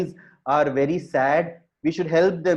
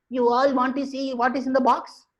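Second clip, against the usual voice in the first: high-pitched teasing question; announcing monkey; 8 semitones higher than usual. Acted. Monkey addressing a thousand waiting animals, announcing. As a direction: build suspense in a raised, sustained pitch.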